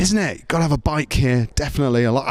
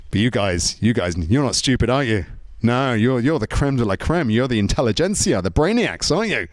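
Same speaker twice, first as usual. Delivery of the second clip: ordinary talk, nothing marked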